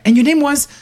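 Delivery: high-pitched caller fumbling a name, half laughing; high, amused mock phone caller; confused caller. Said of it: "So I have the conversation at the end".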